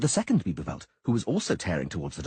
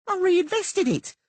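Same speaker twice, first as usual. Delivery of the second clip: high, slow, smug and proud; proud halfling; 6 dB louder, slower than usual. Acted. Halfling proudly boasting to the furious merchant. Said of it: "Teleko said proudly".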